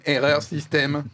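stiff robotic malfunction voice; glitching robot. Pretend. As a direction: play a machine crashing. Joking robot breakdown.

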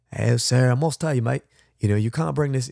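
badly faked Aussie accent; horrible Australian accent. Customs officer stopping a traveller over snacks, bad Australian accent. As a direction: put on a clumsy Australian accent. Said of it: "I'm horrible, uh, Australian accent".

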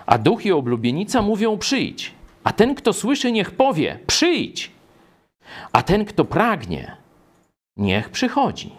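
high, insistent calling out; proclaimed call. Acted. Preacher reading the Spirit and bride's summons, calling out. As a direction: declaim it, pitch climbing on each call.

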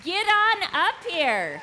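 loud and excited; calling out. Real, not acted. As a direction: call the winner to the stage. Calling winner up.

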